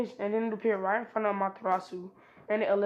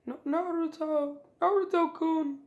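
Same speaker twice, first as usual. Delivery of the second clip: put-on higher, slow, pleading girl voice; 8 semitones higher, 4 dB louder, slower than usual. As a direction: beg him sweetly in a girlish voice. Hinata pleads with Naruto.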